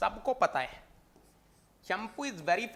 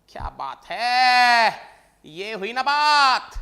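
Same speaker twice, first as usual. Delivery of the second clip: excited praise; 2 semitones higher, 10 dB louder than usual. Real, not acted. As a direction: cheer the student on loudly. Teacher praising correct answer.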